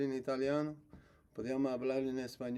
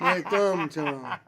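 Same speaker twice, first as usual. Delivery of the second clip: movie line quote; 4 semitones higher, 8 dB louder, much slower than usual. Retold or imitated. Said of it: "We just said it in unison".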